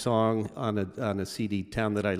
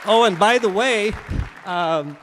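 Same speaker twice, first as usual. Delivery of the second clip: loud, high announcement over applause; calling out; 11 semitones higher, 8 dB louder than usual. Real, not acted. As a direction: call to the whole crowd, voice raised.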